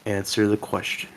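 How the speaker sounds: low, soft threatening order; quiet menace. Acted. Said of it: "I'll be Doctor Lingham".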